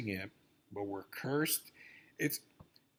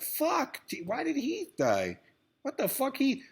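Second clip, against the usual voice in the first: much higher, anguished mock cry; exaggerated dismay; 13 semitones higher than usual. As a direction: wail it like stunned fans. Mock grief over Heath's death.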